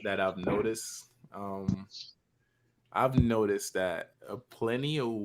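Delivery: ordinary talk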